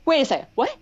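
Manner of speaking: stunned double take, voice jumping up; shocked. Retold or imitated. Shocked reaction retold.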